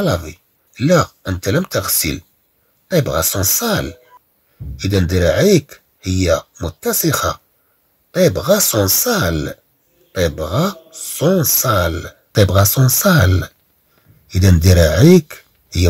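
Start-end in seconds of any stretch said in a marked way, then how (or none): 2.9-13.5 very slow, deliberate; slow reading drill. Shown as a demonstration. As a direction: say each word slowly for repetition. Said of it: "repeated slow reading drill". (قراءة بطيئة للتكرار)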